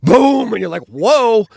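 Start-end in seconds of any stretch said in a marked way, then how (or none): 0.0-0.5 a much higher, louder, bigger vocal boom; imitating a sniper rifle cracking. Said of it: "the sniper rifle goes off". 0.9-1.5 a much higher, louder startled whoa; mock startle at a shot from behind.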